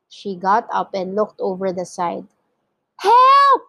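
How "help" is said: high, loud cry for help; piercing, panicked call; frightened cry. A narrator's reading. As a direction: shriek it in alarm. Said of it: "she cried".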